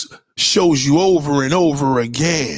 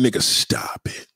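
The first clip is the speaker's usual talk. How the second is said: whisper; 6 semitones lower, 5 dB quieter than usual. Acted. Dismissing liars.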